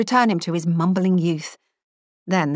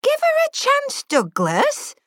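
put-on sharp, high scolding old teacher; stern headmistress; slower than usual. A narrator's reading. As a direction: admonish him like a former headmistress. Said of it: "admonished Edith Hurd".

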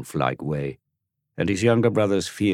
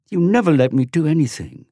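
put-on high, louder child's protest; angry little boy; 11 semitones higher, 7 dB louder than usual. Dorian to Tom, high loud angry protest. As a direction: stamp your foot in words. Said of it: "look of an angry cherub".

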